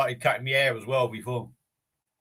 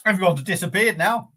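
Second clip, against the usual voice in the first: excited; 6 semitones higher, 8 dB louder than usual. Real, not acted. Laughing that everyone left.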